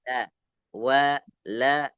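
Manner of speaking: "wa, la" with light, open a vowels; thinned letter demo. Shown as a demonstration. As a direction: say "wa, la" thin, never "wolo". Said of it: "Bacanya ditipiskan".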